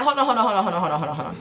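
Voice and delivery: hurried repeats tumbling downward in pitch; rapid-fire interruption. Retold or imitated. Teller cuts off the protesting best friend, rapid-fire interruption.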